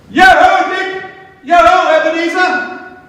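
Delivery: put-on the old master calling his apprentices to stop work; jolly shout. A narrator's reading. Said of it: "And then he shouted out".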